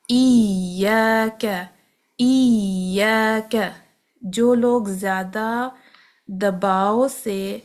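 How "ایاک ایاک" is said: ایاک very slow, ya held; slow ایاک with sustained ya; continuous ya. Shown as a demonstration. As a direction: let the ya sound flow. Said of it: "یا بھی اسی طرح ہی ہے".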